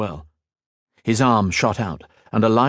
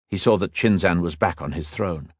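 plain reading voice

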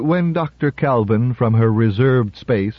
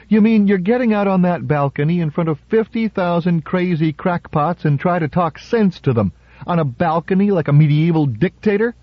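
higher, disbelieving exclaim; alarmed aide protesting; incredulous Lenton; 7 semitones higher than usual. A narrator's reading. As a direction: scoff at the crazy plan, voice raised high.

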